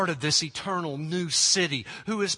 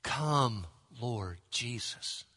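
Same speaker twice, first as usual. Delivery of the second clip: much softer, lower, slow whispered-toward prayer; 4 semitones lower, 6 dB quieter, much slower than usual. Shown as a demonstration. Pastor shows the prayer reading, soft and slow. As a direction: pray it softly, falling quiet. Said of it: "it is clearly a prayer".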